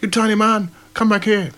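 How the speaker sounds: a shrieking mob voice calling him back; villager voice. Acted. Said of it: "villagers are crowding around trying to catch him".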